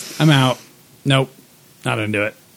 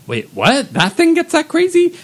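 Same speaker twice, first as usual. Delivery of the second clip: incredulous, jumping high and louder at the end; retold disbelief; 4 dB louder than usual. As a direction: act out a startled double-take. Acts out own shocked reaction.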